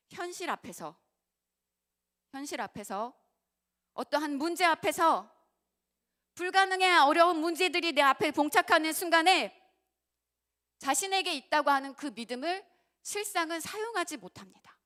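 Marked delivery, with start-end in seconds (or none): 3.9-14.8 loud, high and driving sermon voice; full-throated preacher building to a peak; preaching at full force. Acted. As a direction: preach it out, pressing hard on the crowd. Preacher raising voice.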